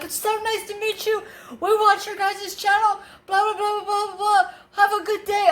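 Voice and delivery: high-pitched mimicry of gushing fans. Fans handing over his food, high gushing imitation. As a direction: rattle it off in a put-on high voice.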